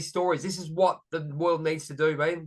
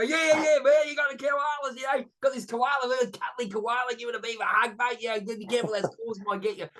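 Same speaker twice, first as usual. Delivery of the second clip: high-pitched, excitable Aussie wildlife-man impersonation; Steve Irwin; 6 semitones higher than usual. Acted. Host hams it up about koalas for Americans, excitable Aussie impersonation. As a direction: play an eager animal handler, voice pitched right up. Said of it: "good Steve Irwin impersonation".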